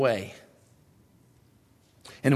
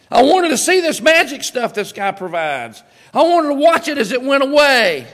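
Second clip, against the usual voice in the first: much higher, louder whiny grievance; Naaman complaining; 10 semitones higher, 6 dB louder than usual. Retold or imitated. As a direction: sulk like a let-down dignitary. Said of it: "Naaman's already said".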